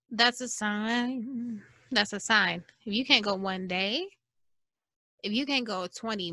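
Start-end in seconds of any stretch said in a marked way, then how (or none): 0.1-1.6 slow, weighty. Acted. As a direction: drag out "that's a sign" very slowly, each word stretched. Repeated line, first slowed.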